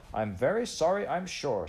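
high, loud, grudging sorry; huffy stallholder forcing out an apology; angry apology. A narrator's reading. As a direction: apologise through clenched anger. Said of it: "she spoke more in anger than in sorrow".

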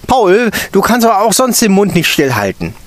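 high, loud, sharp rebuke; teacher needling the chatty boy; stern teacher. A narrator's reading. Stern teacher needling little Paul.

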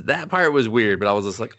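ordinary delivery

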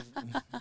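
a quick chuckle at himself; brief amused chuckle; short laugh. Real, not acted. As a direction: laugh it off lightly. Laughs at his own remark.

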